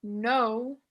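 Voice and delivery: usual speaking voice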